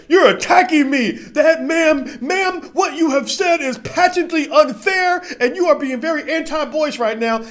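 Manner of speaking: high, slow, overly formal whine; mock offended protest. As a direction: play the wounded victim dodging criticism. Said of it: "I'll be like".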